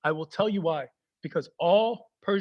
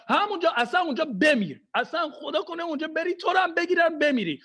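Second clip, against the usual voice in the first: higher, ranting father; mock angry dad; 6 semitones higher than usual. Dad curses son for going to the mall, mock anger. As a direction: rant like a furious Persian dad.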